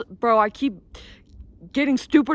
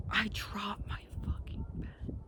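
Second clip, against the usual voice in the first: hushed whisper, much softer; 12 dB quieter than usual. Retold or imitated. Telling viewers about sneaking upstairs, whispered.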